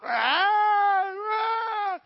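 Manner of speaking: very high, loud drawn-out wail; crying out. Retold or imitated. Sheep stuck a fourth time, wailing very high. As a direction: let out a piercing long cry. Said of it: "the shepherd would start crying out".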